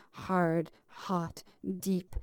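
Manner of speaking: normal talking voice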